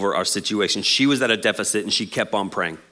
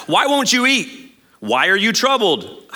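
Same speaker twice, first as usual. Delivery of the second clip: slow, high mock concern; clueless husband; 8 semitones higher, slower than usual. Husband asks why his wife won't eat, mock clueless. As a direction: drag out each question, pitched high.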